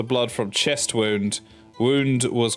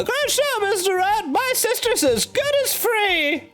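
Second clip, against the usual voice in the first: very high, slow put-on voice; high character voice. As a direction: pipe it up like a cartoon. Reading a character's game line.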